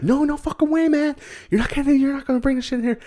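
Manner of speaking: put-on very high, fast, jeering kid voice; mock bully refusal. Bullies refusing to let Horace in, hammed-up high jeering. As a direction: shout him off in a squeaky rush.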